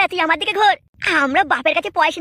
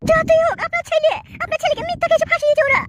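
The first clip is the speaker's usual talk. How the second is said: pleading daughter-in-law; 9 semitones higher than usual. Acted. Daughter-in-law protests to her father-in-law, high and upset. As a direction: plead high for the arrested husband.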